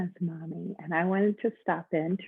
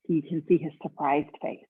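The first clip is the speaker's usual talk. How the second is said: plain reading voice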